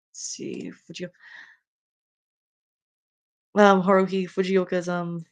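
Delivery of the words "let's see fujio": breathy whispered aside; muttering to self. Real, not acted. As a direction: mutter it to yourself. Muttering while scrolling.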